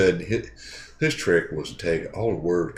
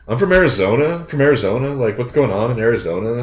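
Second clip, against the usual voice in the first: imitating an Arizona accent, questioning lilt; mock Arizonan, each phrase lifting at the end; Arizona accent impression; 2 semitones higher, 4 dB louder than usual. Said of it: "what's Arizona sound like?".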